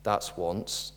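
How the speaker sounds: low, soft, calm warning; quietly. Acted. Wife in the joke warning her stumbling donkey, quiet and calm. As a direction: say it softly, a quiet threat. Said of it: "She said quietly".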